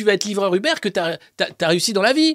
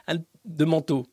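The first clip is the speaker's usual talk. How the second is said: ordinary talk, nothing marked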